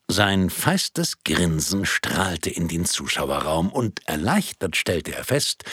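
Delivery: ordinary delivery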